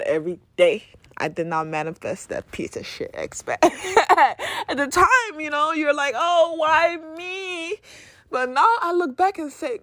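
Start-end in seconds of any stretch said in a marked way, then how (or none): 6.2-7.8 high, drawn-out mock wail; hammed-up woe-is-me; mock self-pity. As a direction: wail it slowly like a melodramatic victim. Acts out past self-pity.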